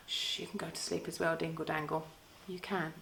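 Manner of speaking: hushed whisper; whispering over a crib. Acted. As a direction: whisper like a baby is sleeping. Children pretending to sleep.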